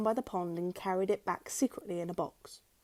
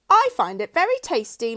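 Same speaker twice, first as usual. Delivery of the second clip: higher, louder, smug; smug wife; 5 semitones higher, 10 dB louder than usual. A narrator's reading. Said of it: "Missus Twit said".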